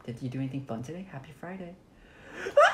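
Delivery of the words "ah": very high shriek; scream. Real, not acted. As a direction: scream in fright. Killer arrives.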